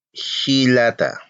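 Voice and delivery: "hilata" said slowly, syllable by syllable, with a breathy h; slow Aymara word. Shown as a demonstration. Teacher models the word slowly for students. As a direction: draw out "hilata" for the class. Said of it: "¿Y el hermano?".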